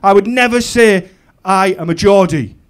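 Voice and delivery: loud, high, defiant proclamation; impassioned tirade. Acted. Comic swears he'll never say he's Geordie, impassioned tirade. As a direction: belt it out with indignant pride. Acted tirade of hometown pride.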